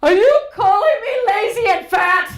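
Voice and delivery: high, loud, teary mock outrage; the son shouting back at his dad over breakfast; indignant kid shout. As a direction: wail it like an offended little boy. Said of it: "And Luca's response was".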